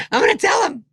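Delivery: very high, loud outburst; hammed-up high-pitched holler; mock excited yell. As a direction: imitate a gleeful tattletale, shouting. Retold excited threat to tell Norm.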